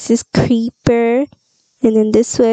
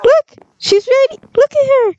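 much higher, thrilled exclamations; excited. Real, not acted. Excited showing the baby.

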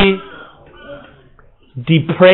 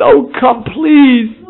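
high, tearful, raised plea; pleading father; 8 semitones higher, much slower than usual. Retold or imitated. A father begging his angry son to come in, tearful. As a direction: cry out to him as a begging father.

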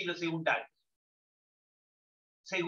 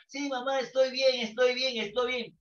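higher voice for the son on the phone; voicing the son; 5 semitones higher than usual. Brother posing as dead son reassures mother, voiced higher. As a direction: pitch it up, repeating estoy bien.

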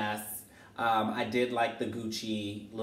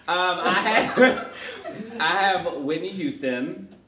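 giggling through it; laughing; 7 semitones higher, 7 dB louder, much slower than usual. Real, not acted. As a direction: laugh as you announce it. Laughing while announcing the next pick.

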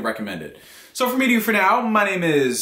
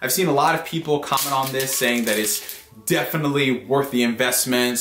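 ordinary delivery

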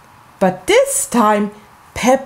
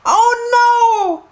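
high, alarmed cry again; repeated yell; 12 semitones higher than usual. Acted. Peppa falling again, yelling in alarm. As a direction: cry out once more while tumbling. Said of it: "yelled Peppa".

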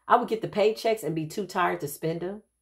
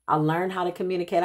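ordinary talk

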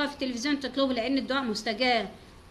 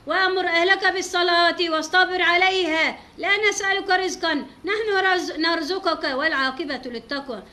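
loud, raised, drawn-out recitation of the verse; Quran recitation; 6 semitones higher, 7 dB louder than usual. Retold or imitated. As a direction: recite it like chanting the Quran aloud. (تلاوة قرآن بصوت عالٍ ومرتفع)

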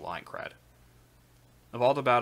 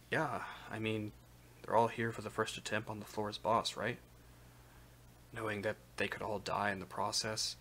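quiet; 7 dB quieter than usual. Acted. Fencer answers quietly.

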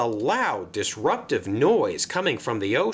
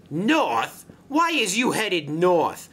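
higher-pitched incredulous challenge; indignant Vinny; 6 semitones higher, slower than usual. Acted. Passenger engine questioning the barge's route, indignant.

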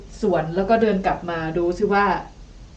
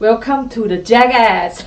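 loud announcement; 7 dB louder than usual. Acted. Announcing stunt loudly.

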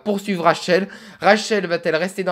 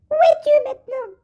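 put-on much higher, thin old woman's voice; little old lady voice. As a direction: squeak it out like a tiny grandma. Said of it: "on va faire une petite voix de vieille".

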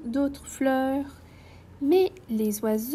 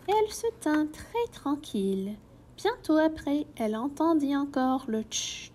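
usual speaking voice

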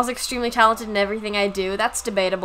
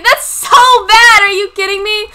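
loud, high, drawn-out protest; indignant outburst; 8 semitones higher, 15 dB louder than usual. Real, not acted. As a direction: cry out in disbelief. Indignant reply about the accent attempt.

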